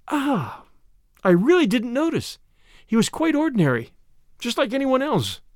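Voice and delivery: high, lightly voiced Tuppence; high-voiced heroine at a loss; regretful heroine. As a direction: pitch it up, apologetically trying to remember. Narrator voices Tuppence trying to remember.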